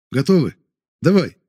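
high loud command; shouting. Acted. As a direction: call out the signal. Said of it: "крикнул я".

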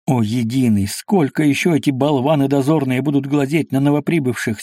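usual speaking voice

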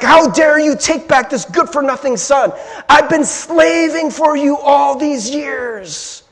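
put-on older brother snapping at his father, hurt and furious; angry older brother. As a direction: throw the injustice back at the father. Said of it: "gets really mad and snaps at the father".

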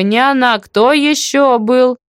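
emphatic, slowing at the end; insistent call. A narrator's reading. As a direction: press a deaf neighbour for an answer. Shouting questions to deaf neighbour.

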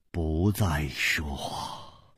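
低沉轻声，慢慢拖长; 屏息般轻缓地讲; 压低声音叙述. A narrator's reading. Narrator hushes for the standoff. (低缓地收声)

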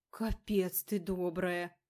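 slow, loud fake horror; mock horror. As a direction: ham up the shock. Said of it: "притворно ужаснулся брат". (притворный ужас)

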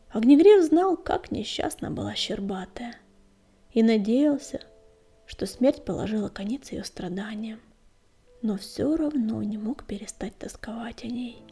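ordinary delivery

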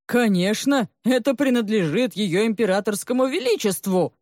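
growled retort. A narrator's reading. Li Dodzo retorts to the sobbing maid, growling. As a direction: growl it back at the accused. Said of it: "прорычал Ли Додзо".

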